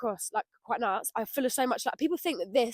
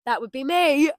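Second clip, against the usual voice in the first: usual speaking voice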